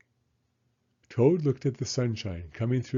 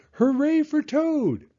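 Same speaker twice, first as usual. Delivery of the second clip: cheering shout; 7 dB louder than usual. Acted. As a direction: cheer him on from the seats. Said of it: "as he shouted".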